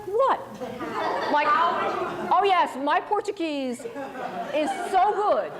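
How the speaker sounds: high incredulous what, then a mock boast; mock-proud sarcastic boast; sarcastic disbelief. Mocking idea of approving translation.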